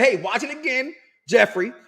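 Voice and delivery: normal talking voice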